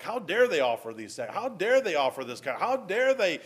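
loud indignant rebuke, rising to a peak on the third how dare; a mock furious preacher demo of the passage; cutting tone. Shown as a demonstration. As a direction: hammer each how dare like an angry preacher. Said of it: "preached or taught with ... a cutting tone".

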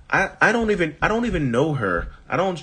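high, startled sidekick voice; acting flying monkey. Flying monkey balks at the new girlfriend's request, high startled voice. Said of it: "you're acting and cracking me up".

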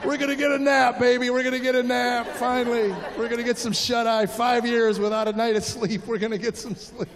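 gleeful parent. Retold or imitated. Parents skipping off from kindergarten, giddy and gleeful. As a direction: crow it like you're finally free.

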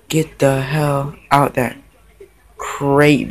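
ordinary delivery

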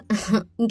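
brief amused laugh; a quick little laugh before answering; short chuckle. Acted. Elder sister amused by younger sister's complaint about her looks, short chuckle.

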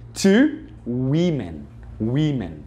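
women said slowly as "wimen"; women with an I sound. Shown as a demonstration. Teacher models the plural for students, slow "wimen". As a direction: stretch the first vowel of women into an I. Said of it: "vira women".

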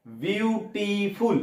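very slow three-part বিউটিফুল; syllable-by-syllable. Shown as a demonstration. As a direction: stretch বিউটিফুল into three drawn-out beats. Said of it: "উচ্চারণ দেখো".

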